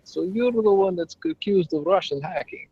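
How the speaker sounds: put-on Russian accent. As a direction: tease him in a Russian accent. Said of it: "I do my Russian accent".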